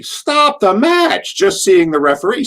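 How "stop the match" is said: very high, drawn-out mock holler; imitated heckle. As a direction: call it out high and slow like a heckler. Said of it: "And I'd say".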